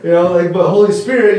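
ordinary talk, nothing marked

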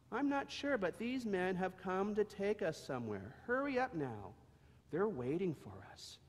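a mother calming her foal while rushing him; mama donkey telling Howie to hurry. Acted.

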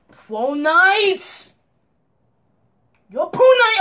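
furious real yell at a cheating teammate; angry shout. Real, not acted. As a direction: yell at the teammate who betrayed you.

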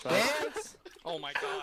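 high startled cry, then a gasp; shocked yelp. Real, not acted. Player reacting to a teammate's sudden move, high shocked yelp. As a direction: yelp in surprise at the sudden attack.

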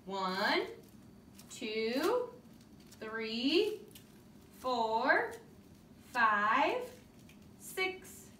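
"One" to "eighteen", each number alone with a pause after it; counting aloud. Shown as a demonstration. Teacher leading children in counting, each number from "One" spaced out. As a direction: chant "One, two, three" onward as separate, held beats. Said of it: "ready to help me count".

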